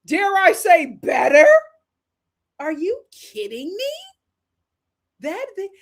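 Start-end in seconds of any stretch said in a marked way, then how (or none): 0.0-3.9 high-pitched incredulous challenge; indignant questions pitched way up; heated. Retold or imitated. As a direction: reenact a heated, disbelieving retort. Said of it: "I was so heated".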